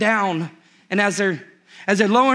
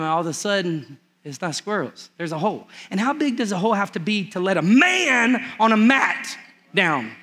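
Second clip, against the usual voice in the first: normal talking voice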